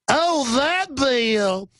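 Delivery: high, extremely drawn-out Southern belle wail; mock Scarlett O'Hara. Host replaying the congressman's reaction, mock Southern belle voice. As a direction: stretch each word in a hammed-up high Southern voice. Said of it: "He sounded like Scarlett O'Hara".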